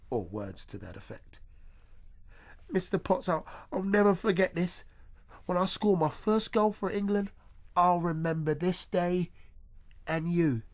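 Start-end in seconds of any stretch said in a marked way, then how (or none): 2.7-10.6 lightly voiced excited boy. Boy thanking teacher, voiced.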